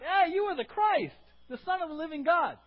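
high, loud eager cry; excited Peter. Pretend. Peter proclaiming Jesus the Christ, high eager cry. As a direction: announce it with glee.

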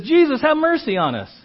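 high-pitched acted plea; calling plea. Lepers begging Jesus for mercy, high calling plea. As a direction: call it out high in one run.

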